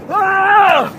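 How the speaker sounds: high yelp of panic, hammed up; mock scream. Surfer acting out a wipeout for the audience, hammed-up high yelp. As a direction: yelp like you're tumbling in a wave. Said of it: "going like this".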